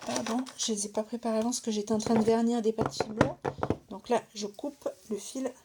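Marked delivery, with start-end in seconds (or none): none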